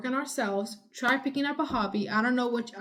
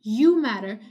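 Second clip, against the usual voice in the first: emphatic shout; 8 dB louder, slower than usual. Real, not acted. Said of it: "Sorry for screaming a little".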